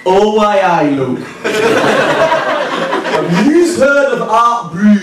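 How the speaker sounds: slow, exaggerated high-pitched northern English accent; broad mock northern English voice, swooping up high; generic northern English accent. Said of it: "do a generic northern accent".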